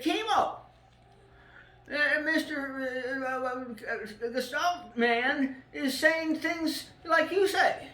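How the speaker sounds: high, slow, put-on voice of his friend; imitating friend Chris. Retelling his friend Chris telling him about Gestalt, high and slow.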